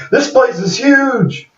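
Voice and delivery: high, hammed-up screaming call; mock scream. Man flying to the moon calls to Annie, high mock scream. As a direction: shriek it like a giddy cartoon hero. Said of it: "And he's screaming".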